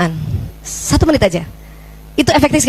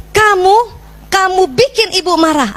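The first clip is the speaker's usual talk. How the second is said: higher, accusing, finger-pointing tone; blaming scold; 5 semitones higher, slower than usual. Shown as a demonstration. The wrong way: mother blames her son for her anger. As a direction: throw the blame onto the child. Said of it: "itu yang keliru".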